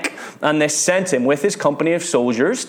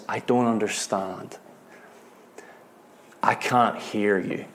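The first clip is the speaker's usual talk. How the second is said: softer, lost and pleading with God; hushed plea; 4 semitones lower, 6 dB quieter than usual. Retold or imitated. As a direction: pray it quietly, with long pauses. Soldier prays over dying friend.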